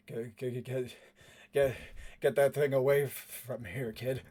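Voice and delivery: halting, stuttered warning; faltering, scared plea; frightened stammer. Acted. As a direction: stammer it out, unnerved. Frightened man stammers at puppet.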